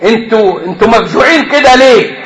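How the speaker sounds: ordinary delivery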